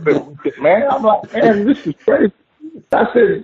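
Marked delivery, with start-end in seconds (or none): 1.3-2.3 laughing. Retold or imitated. Laughing while quoting himself.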